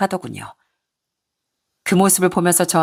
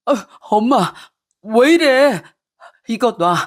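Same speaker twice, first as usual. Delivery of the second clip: pained plea; 3 semitones higher, slower than usual. Acted. Son pleading while his mother pulls his hair. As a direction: beg her to stop, hurting.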